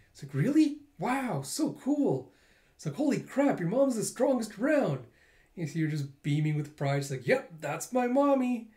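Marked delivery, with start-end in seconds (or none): 0.3-2.3 put-on high-pitched, wide-eyed little kid voice; amazed kid. Classmate gushing over Kokoro's mom, high and slow. 3.0-5.1 put-on high, excited little kid voice; impressed kid. Classmate marveling at the mom's strength, in a high kid voice. 7.3-8.7 put-on high, slow, proud child voice; proud little girl. Kokoro bragging to classmates about her mommy, high and proud.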